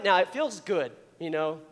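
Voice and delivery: ordinary delivery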